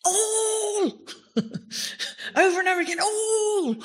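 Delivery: high, laughing cry of joy on all; overjoyed preacher, laughing and crying out; ecstatic exclamation. Real, not acted. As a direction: burst out high with delighted laughter. Preacher exults over the word all.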